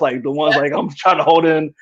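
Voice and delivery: ordinary talk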